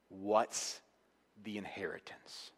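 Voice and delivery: "the inheritance" breathed in a whisper; whispered question. Acted. Preacher hushing the congregation before the answer, whispered. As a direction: drop to a hushed whisper for "the inheritance".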